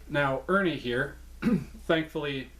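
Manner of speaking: usual speaking voice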